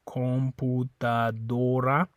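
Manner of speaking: "computadora" slow, with pure O sounds; Spanish computadora. Shown as a demonstration. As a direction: spell out "computadora" with clean O's. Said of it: "Both those are gonna be an O sound".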